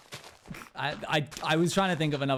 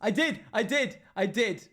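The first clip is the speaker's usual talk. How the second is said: relieved joy; 5 semitones higher than usual. Real, not acted. Found the favorited video.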